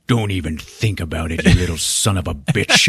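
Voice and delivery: low, menacing warning; acted threat. Tom warning the slug he caught, low mock menace. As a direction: threaten it like an action hero.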